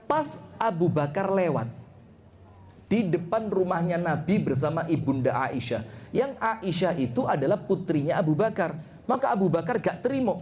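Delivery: usual speaking voice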